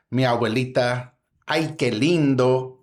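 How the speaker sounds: usual speaking voice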